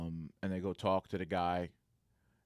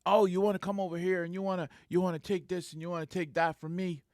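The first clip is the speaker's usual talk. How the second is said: high, loud challenge in another man's voice; retold belligerent rant; 8 semitones higher, 4 dB louder than usual. Crazy guard confronting his supervisor, mock belligerent rant. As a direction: imitate someone puffed up and ranting.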